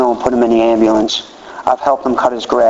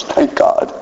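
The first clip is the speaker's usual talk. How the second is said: laughing quip. Retold or imitated. Wife's relieved quip, laughed.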